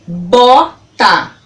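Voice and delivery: BOTA split into bo - ta with a gap; syllable by syllable. Shown as a demonstration. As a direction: sound out BOTA in two clear beats. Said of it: "falando elas pausadamente pra você".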